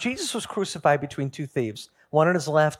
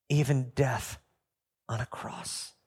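hushed scripture; 4 semitones lower, 7 dB quieter than usual. Acted. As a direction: voice sinking to a breathless hush on on a cross. Quoting scripture, voice falls away.

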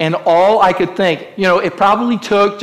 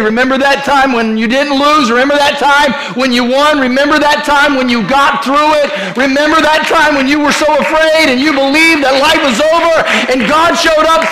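high, loud preaching build; rally your own soul; 9 semitones higher, 5 dB louder than usual. Acted. Preacher telling the congregation to speak to their soul, loud and high. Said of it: "talk to your soul and say".

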